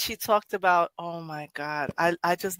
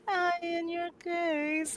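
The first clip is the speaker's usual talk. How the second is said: higher, slow, softer echo of the line; quoting her line; 9 semitones higher, 8 dB quieter, much slower than usual. Retold or imitated. Host savouring the poet's line, slow and soft. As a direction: linger over it dreamily. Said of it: "the way she wrote it".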